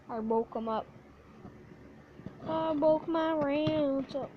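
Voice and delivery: usual speaking voice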